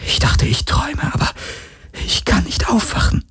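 high voiceless whisper; scared woman whispering after waking to horror; frightened whisper. Acted. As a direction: whisper it as if still in a nightmare. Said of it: "flüsterte sie".

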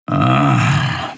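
low grumbling grunt of a big cat; grumbled. Acted. Cat warrior checks the captured weapon, low grumble. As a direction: rumble it in the chest. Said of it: "brummte der Skria".

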